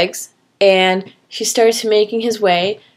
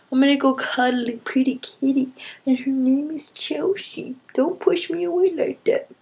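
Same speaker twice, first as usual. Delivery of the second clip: high, hushed cooing pet voice; 3 semitones higher, 8 dB quieter than usual. Real, not acted. She cuddles her cat and coos at it softly, high baby talk. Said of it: "cooing at cat".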